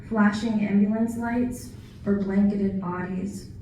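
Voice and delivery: measured recital, softening on the closing line; poetry reading. Acted. Poet remembering veterans lost at the home, poetry reading. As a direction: recite in paused phrases, letting the ending drop. Continues poem about veterans.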